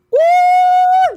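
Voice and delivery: high, loud shocked whoop. Real, not acted. Mom reacting to her son's rude remark, loud high whoop. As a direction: let out a big whoop.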